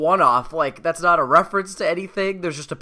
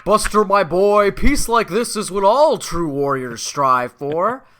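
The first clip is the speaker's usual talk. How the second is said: slow, louder, higher, hammed-up royal proclamation with a laugh; CD-i King Harkinian impression; 4 semitones higher, 6 dB louder, slower than usual. Podcast host doing the Zelda king to his co-host, hammed-up and slow. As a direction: boom it out like a cheesy cartoon king. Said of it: "Zelda's dad from the C D I Legend of Zelda games".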